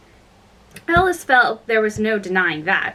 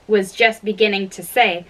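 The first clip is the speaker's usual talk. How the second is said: ordinary delivery, nothing marked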